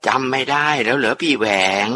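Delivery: high, soft and otherworldly, fading away; a hurt spirit pleading softly; eerie ghost voice. Acted. Ghost in the aunt asks Uncle Waeng if he forgot, high eerie whisper-soft voice. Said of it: "เสียงป้าจำปีก็พูดออกมาอีก".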